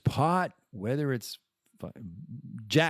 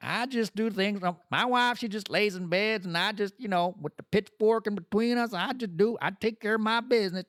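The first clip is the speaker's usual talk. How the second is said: put-on high creaky old man voice; lightly hammed old man; old man character; 8 semitones higher than usual. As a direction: play a proud old husband, pitched up.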